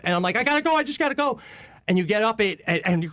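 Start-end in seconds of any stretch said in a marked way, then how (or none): 0.3-1.4 high, fast acted panic; freaking out, retold. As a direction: blurt it in mock desperation. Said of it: "you're just freaking out".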